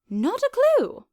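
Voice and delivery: high, loud cheerful chirp; Doctor chirps cheerfully at Ditzy; chirped happily. A narrator's reading. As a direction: toss it off cheerfully. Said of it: "the doctor chirped happily".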